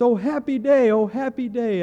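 loud, high, beaming repeated cry; joyful exclamation. Retold or imitated. Man in the preacher's face at every break, a loud joyful cry. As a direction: call it right into his face with glee. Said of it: "this man was in my face".

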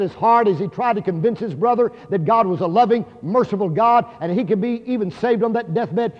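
ordinary delivery

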